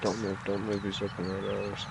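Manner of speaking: hushed hunter's whisper; wildlife watching whisper. Real, not acted. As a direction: keep it low so the bird won't hear. Whispering while hunting a bird.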